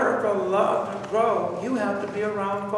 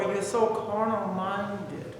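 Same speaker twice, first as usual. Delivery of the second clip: ordinary talk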